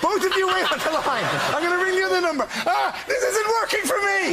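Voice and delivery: frantic president on phones. Retold or imitated. Panellist mocking the president with three phones, high frantic voice. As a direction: squeal it like a panicked man with three phones. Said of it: "He's not happy with it".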